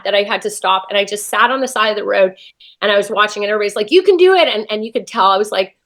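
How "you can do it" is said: higher, louder imitated cheer; retold encouragement. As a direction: call out like a cheering spectator. Imitates spectators cheering her on.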